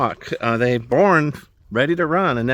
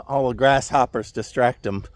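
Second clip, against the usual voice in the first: usual speaking voice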